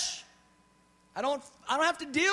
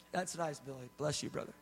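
mild mock reply; 9 semitones lower, 10 dB quieter than usual. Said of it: "You'd be like".

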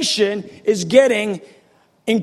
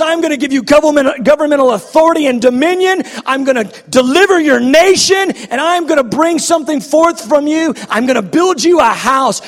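louder, fast, driving declaration; preaching at full force; 3 semitones higher, 4 dB louder, faster than usual. Retold or imitated. Preacher voicing God's promises to David, full force. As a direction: thunder the promises out. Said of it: "He goes".

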